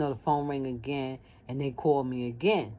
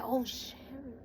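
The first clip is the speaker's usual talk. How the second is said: soft, high, emotional cry of a name; retold tearful mother; 11 semitones higher, 8 dB quieter, slower than usual. Mother hearing her daughter on the phone, tearful. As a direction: imitate a mother overcome, voice small and high. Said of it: "she was on the other end crying".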